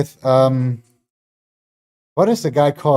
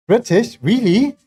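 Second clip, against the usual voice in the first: surprised; 8 semitones higher, slower than usual. Real, not acted. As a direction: genuinely caught off guard by the answer. Chat says he is British.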